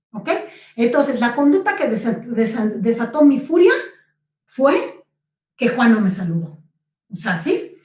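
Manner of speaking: normal talking voice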